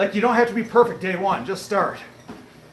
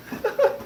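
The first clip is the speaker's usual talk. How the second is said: high laugh; 3 dB quieter than usual. Real, not acted. Laughs at chat's bottle joke.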